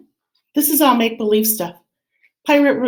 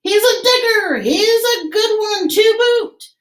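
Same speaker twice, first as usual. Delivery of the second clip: pirate yell; 10 semitones higher than usual. Acted. Head pirate calls to his crew about the boy, high excited yell. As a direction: shout the praise across to the crew. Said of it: "yelled back to his crew".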